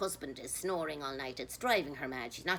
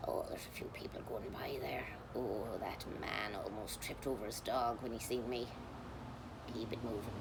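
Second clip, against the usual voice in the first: hushed, lower running remarks; quiet whispered gossip; whispered commentary; 7 semitones lower, 7 dB quieter than usual. Real, not acted. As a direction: whisper so the passers-by won't hear. Commenting on passers-by.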